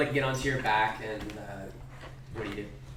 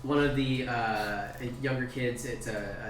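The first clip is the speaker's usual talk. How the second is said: ordinary delivery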